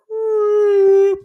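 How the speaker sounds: high, loud whoop of a perfect drive. Retold or imitated. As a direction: sing out the ball flying. Mimicking a golf drive.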